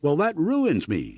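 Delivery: high, drawn-out cry of protest; narrated shout without full volume. A narrator's reading. Ruined farmer to clerk, shouting.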